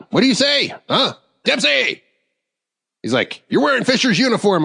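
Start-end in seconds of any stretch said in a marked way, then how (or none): 0.1-2.0 high-pitched mock bellow; acted-out incredulous summons; retold chief's yell. Chief on phone, then yells his name. 3.5-4.6 high-pitched mock outrage; acted-out indignant accusation; retold chief's accusation. Chief confronts him in the story.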